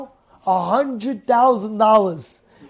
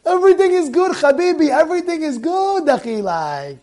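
high lilting voice, dropping on the last word; demonstrated upbeat reassurance; cheerful acceptance; 5 semitones higher than usual. Shown as a demonstration. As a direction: soothe a buddy in a sing-song. Models cheerful acceptance after mishap.

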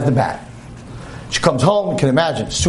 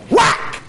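whack sound. Retold or imitated. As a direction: shout the bat's impact. Hitting God metaphor sound.

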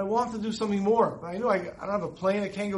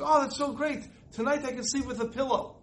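excited shriek; 6 semitones higher than usual. Retold or imitated.

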